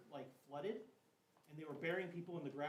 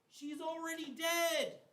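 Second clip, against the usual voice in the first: high, loud and slow, a grieving cry; mourners' wail; 11 semitones higher, 9 dB louder, slower than usual. Acted. Weeping family tells Jesus the girl is gone, grieving wail.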